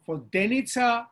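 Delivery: Vodenica with the weight on DE; voDEnica, sounded out as an example; antepenultimate stress. Shown as a demonstration. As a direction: lean on DE in Vodenica, two syllables back from the last. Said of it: "It's two syllables back".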